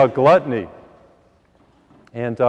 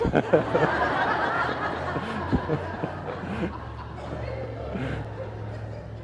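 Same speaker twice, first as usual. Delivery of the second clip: soft airy chuckle at his own punchline; breathy laugh; 5 semitones higher, 9 dB quieter than usual. Real, not acted. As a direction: let a quiet breathy laugh roll out.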